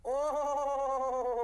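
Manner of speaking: long, high, wavering "oh" of realisation; drawn-out "oho-ho-ho". Acted. Teacher reacting as the idea clicks, long drawn-out "oho". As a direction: exclaim a long rolling "oho-ho-ho".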